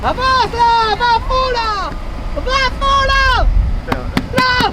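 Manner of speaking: high, excited push to go; excited urging. Real, not acted. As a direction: cheer the driver on hard. Urging driver on.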